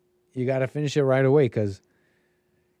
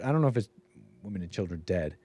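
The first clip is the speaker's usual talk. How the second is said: usual speaking voice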